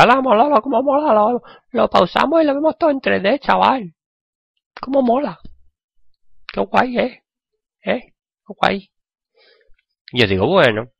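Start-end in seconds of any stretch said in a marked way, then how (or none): 0.0-5.4 much higher squeaky put-on voice; excited kid voice. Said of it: "Y me decía". 6.5-8.9 much higher squeaky put-on voice, thrilled; excited kid voice. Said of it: "Y me decía".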